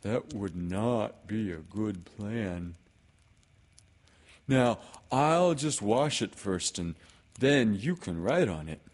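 measured storybook-bear delivery; Pooh. Acted. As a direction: explain the plan carefully in character. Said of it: "said Pooh".

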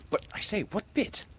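put-on high-pitched boy's question; high voice of a curious child. A narrator's reading. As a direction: pipe up in a high, eager boy's voice. John questions Peter in the story.

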